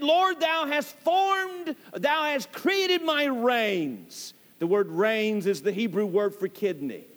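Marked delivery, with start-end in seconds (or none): 0.0-4.3 solemn prayer. Acted. Psalmist's prayer voiced slowly.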